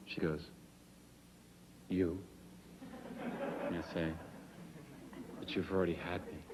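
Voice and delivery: soap-opera lover. Acted. Acting the audition love scene.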